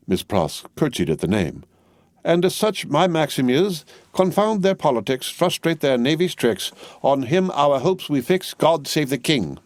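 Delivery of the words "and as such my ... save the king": higher, slowing into a solemn anthem line; high proud recital. A narrator's reading. Miss Pross reciting God Save the King to Jerry, proud and high. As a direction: recite it like a loyal hymn.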